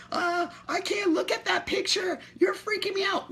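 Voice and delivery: high, squeaky mock voice of a fragile viewer; mock offended viewer. Mocking an easily shocked viewer, high squeaky voice.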